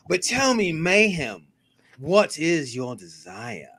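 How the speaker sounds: slow, drawn-out, sinking to a low finish; slow theatrical interrogation of a co-host; Lucifer's seductive question. Acted. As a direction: draw it out like a tempter coaxing a confession. Playing Lucifer's catchphrase to co-host.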